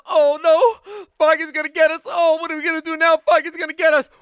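very high, loud squeaky panic; panicked mice. Acted. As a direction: squeal in terror of the cat. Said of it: "looked at one another and said".

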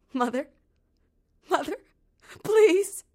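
begging with the last of her hope; desperate plea. Acted. Girl begging her lost mother.

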